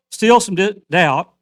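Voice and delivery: preaching at full force. Acted. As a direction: press the word doubt on the hall. Preaching climax on doubt.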